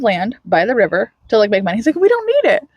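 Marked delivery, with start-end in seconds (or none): 2.0-2.7 high-pitched mock objection; retold protest. [name] explaining the land sale, high-pitched retelling. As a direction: object in a raised, put-on pitch.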